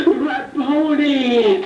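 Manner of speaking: high, drawn-out tearful exclamation; long emotional high-pitched call; overwhelmed cry. Acted. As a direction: cry out slowly in astonished recognition. Reader acts Mary recognizing Jesus.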